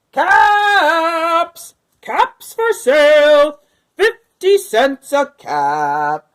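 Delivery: loud, slow street call; peddler calling out. A narrator's reading. Peddler calling out to the town, selling caps loudly. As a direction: cry your wares down the street. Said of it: "calling out".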